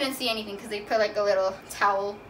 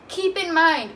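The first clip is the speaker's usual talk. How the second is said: louder emphasis; 7 semitones higher, 6 dB louder, slower than usual. Shown as a demonstration.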